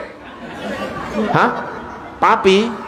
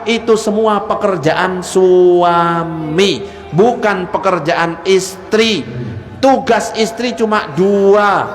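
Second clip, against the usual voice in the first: slow emphatic; slower than usual. Real, not acted.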